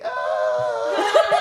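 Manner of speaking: short laughing yelp; a burst of disgust and laughter; startled laughing cry; laughing shout. Retold or imitated. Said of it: "I was just like".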